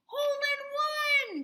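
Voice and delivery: high, loud, slow cheer; triumphant cry. A narrator's reading. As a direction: crow over the win. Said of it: "cried Froggy".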